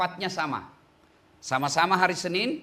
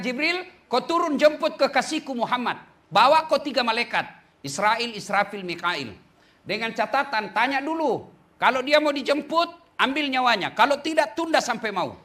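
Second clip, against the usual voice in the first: commanding voice; 6 semitones higher, 5 dB louder than usual. Retold or imitated. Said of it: "Allah perintahkan".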